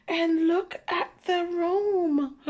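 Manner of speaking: high, slow shocked look at the mess; dismayed. Acted. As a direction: gasp at the wreck of the room. Reader shocked at messy room.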